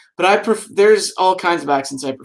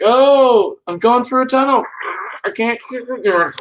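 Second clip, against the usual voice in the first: higher and louder mock girl faking a tunnel; mock bad phone excuse; 7 semitones higher, 4 dB louder than usual. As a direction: ham up a fake excuse about losing signal.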